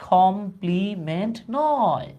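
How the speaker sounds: Complement broken slowly into syllables, then নয় drawn out; emphatic negation. Real, not acted. Teacher correcting the class's likely mistake, slow emphatic stress on complement নয়.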